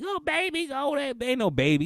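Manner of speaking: high-pitched imitation of mommy; mock-female high voice. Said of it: "mommy like".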